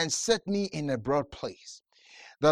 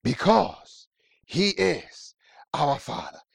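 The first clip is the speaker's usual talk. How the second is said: ordinary talk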